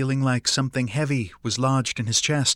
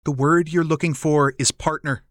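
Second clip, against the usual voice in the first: ordinary delivery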